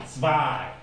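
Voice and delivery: ordinary talk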